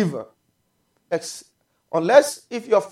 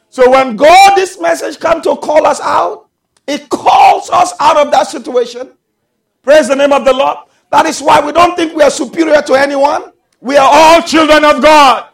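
raised sermon voice, building louder; emphatic preaching; 5 semitones higher, 8 dB louder than usual. Real, not acted. Preacher telling church all are God's children, emphatic preaching. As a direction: press it home to the congregation.

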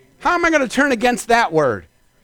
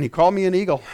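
lower, matter-of-fact answer; settled reply; 3 semitones lower, much faster than usual. Acted. Believer who hears it right, low assured reply. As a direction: drop the pitch and state it calmly.

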